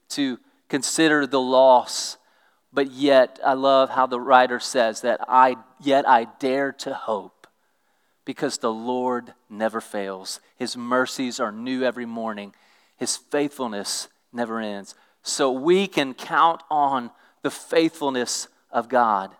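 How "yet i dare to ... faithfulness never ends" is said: scripture reading. Acted. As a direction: read the promise out to the church. Rereading the verse.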